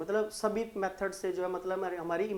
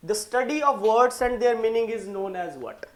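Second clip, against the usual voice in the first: slow question reading; 3 semitones higher, 6 dB louder, much slower than usual. Acted. Reads quiz question slowly.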